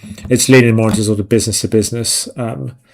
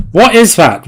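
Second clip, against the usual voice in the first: sudden high, louder "what is that"; startled; 9 semitones higher, 5 dB louder than usual. Real, not acted. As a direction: caught off guard by a pop-up. Startled by pop-up ad.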